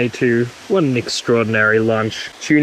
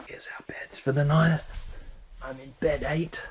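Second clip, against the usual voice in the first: soft whisper; 2 semitones higher, 9 dB quieter than usual. Real, not acted. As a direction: whisper so others sleep. Whispering in the bunk room.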